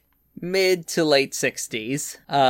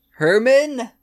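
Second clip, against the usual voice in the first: calling out; 9 semitones higher, 5 dB louder than usual. Acted. Landlady calling out.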